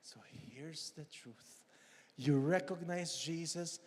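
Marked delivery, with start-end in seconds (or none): 0.0-1.6 hushed, slow whisper. Real, not acted. Preacher whispering the point to the congregation. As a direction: lean in and whisper it.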